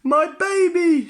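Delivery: very high, loud, slow cry; joyful cry. A narrator's reading. Missus Wolf finds her son, crying out in joy. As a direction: cry out in joy at your lost child. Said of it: "she cried".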